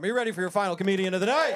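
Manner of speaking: pumped-up emcee call to the crowd, ending on a rise. Real, not acted. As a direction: rev up the crowd a second time. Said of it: "I'm gonna ask one more time".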